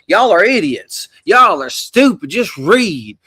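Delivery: loud, high, slow preacher rant; loud, high mock rant at his followers; mocking Derek Johnson. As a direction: mimic a ranting evangelist scolding his audience. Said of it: "he's just like".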